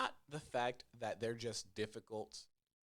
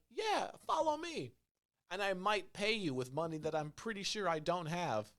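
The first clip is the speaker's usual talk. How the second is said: put-on cocky kid; 9 semitones higher, 3 dB louder than usual. Escort kid running off, high breezy kid voice. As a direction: play the kid leading you into danger. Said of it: "he's just like".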